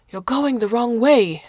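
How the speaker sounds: loud, high call across the water; shout. A narrator's reading. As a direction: shout it out to a friend in a passing boat. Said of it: "one shouted".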